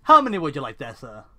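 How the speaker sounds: loud call that drops off on "sir"; shouting shopkeeper. Acted. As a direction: shout to the customer from the doorway. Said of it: "bobs his head in the doorway and shouts".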